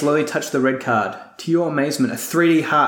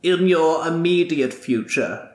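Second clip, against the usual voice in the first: ominous warning. Acted. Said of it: "she warns".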